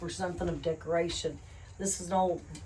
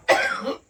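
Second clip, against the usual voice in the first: coughing on dust; 8 dB louder than usual. Real, not acted. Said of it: "the dust is getting to me".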